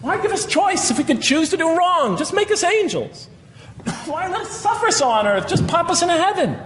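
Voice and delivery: a hammed-up challenger asking why God bothers; mock sceptic. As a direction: play the doubter who demands easy answers. Voicing a sceptic's rhetorical objections.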